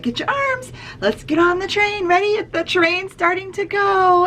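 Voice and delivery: high, eager call to start the game; excited teacher. Shown as a demonstration. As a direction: get the kids pumped for the train. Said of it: "So I'm like".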